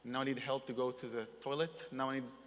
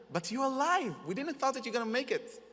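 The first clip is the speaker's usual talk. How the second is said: high, loud, overjoyed cry; a friend's joyful shout on hearing he survived; joyful disbelief; 10 semitones higher, 5 dB louder than usual. Retold or imitated. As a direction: burst out with joy that he lived. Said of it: "I hear the joy in his laugh".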